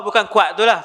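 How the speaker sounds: usual speaking voice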